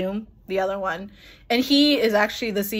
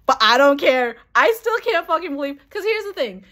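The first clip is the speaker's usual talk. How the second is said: much higher, bursting with disbelief; still thrilled; 6 semitones higher than usual. Real, not acted. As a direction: speak like you still can't believe it. Still overwhelmed by the moment.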